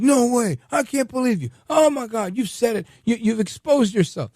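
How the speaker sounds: high, loud outraged yelling; retold yelling. A handyman yelling in disbelief at the homeowner's politics. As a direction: yell it, shocked and indignant. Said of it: "He starts literally yelling".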